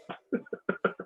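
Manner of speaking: soft, breathy chuckle; light laugh. Real, not acted. Host amused by own Safeway sign-off, light laugh. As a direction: chuckle quietly to yourself after a joke.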